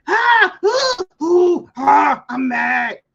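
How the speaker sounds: high, loud huffing ah ooh; mock frustrated outburst. Imitating angry caller's loud high huff. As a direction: ham up the caller's anger. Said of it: "'cause she went".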